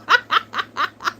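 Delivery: high cackling laugh; burst of laughter. Real, not acted. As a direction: laugh it out loud. Laughing after cursing show.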